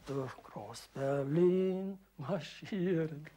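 slow drawn-out quote; mock announcer. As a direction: echo the title slowly. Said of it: "sagte er an".

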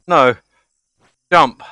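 ordinary delivery

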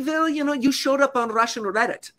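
meticulous, deliberate villain voice, each word placed; Vlad as Bond villain. Retold or imitated. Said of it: "like, Bond villain type mannerisms".

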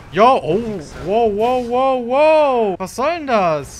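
high, loud wows, then indignant; startled and annoyed; surprised. Real, not acted. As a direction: caught off guard by the blockade. Surprised by a roadblock.

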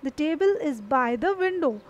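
The table is by the window, spoken slowly; slowly, word by word; slow example sentence. Shown as a demonstration. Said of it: "और एक और बाय है". (धीमा उदाहरण)

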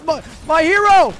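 high, loud delighted shout; excited cheer. Real, not acted. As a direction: cheer your rescuer loudly. Teammate saved, cheering.

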